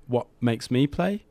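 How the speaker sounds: ordinary talk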